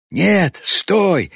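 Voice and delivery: high, loud cry to halt; desperate shout. A narrator's reading. Winded old man calls after the girl, desperate shout. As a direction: yell after someone running ahead. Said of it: "кричал где-то позади запыхавшийся Корки".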